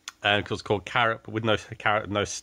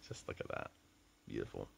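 hushed, admiring whisper; 20 dB quieter, faster than usual. Real, not acted. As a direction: breathe it out in awe. Admiring the poured drink.

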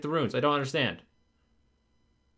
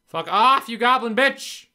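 louder, snapping at the goblin; frustrated outburst at a game monster; angry yell; 5 semitones higher, 5 dB louder, slower than usual. Real, not acted. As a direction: tell off the goblin loudly. Yelling at a game enemy.

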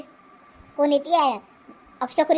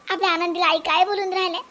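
ordinary delivery

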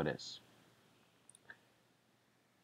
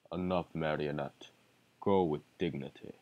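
deep villain voice; 9 semitones lower, 5 dB quieter than usual. Acted. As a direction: speak as a cold deep villain. Villain line.

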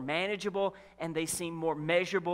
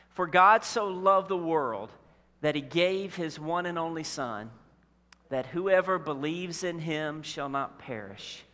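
scripture reading; 4 semitones lower, slower than usual. Shown as a demonstration. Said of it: "Read this out loud with me".

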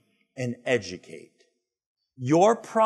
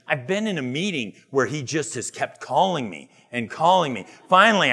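ordinary talk

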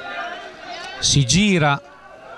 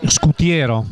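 normal talking voice

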